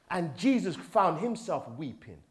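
ordinary delivery

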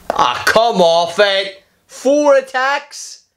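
slow, high wail of disbelief; outraged disbelief. Real, not acted. Gamer protests his rival's four attacks, slow high wail. Opponent lands four hits.

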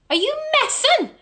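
high, loud, fast outburst; loud squeal of disbelief; incredulous shriek. Real, not acted. Outraged at the answer.

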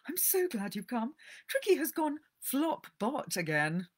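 put-on high, fretful old lady's voice; worried rich lady telling the vet her dog is ill; anxious lady. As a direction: fret over the poorly dog. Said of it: "looking at her pet anxiously".

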